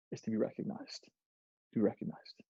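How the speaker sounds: breathy whisper on recognized; hushed repetition; whispered emphasis. Real, not acted. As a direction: whisper it for weight. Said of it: "emphasis repeated".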